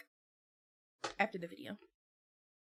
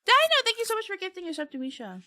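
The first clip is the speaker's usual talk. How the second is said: excited thanks; 11 semitones higher, 4 dB louder, faster than usual. Real, not acted. Thanking a gift sub.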